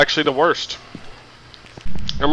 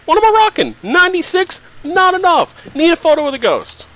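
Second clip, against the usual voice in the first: squeaky high voice. Real, not acted. Checking sanity, high voice.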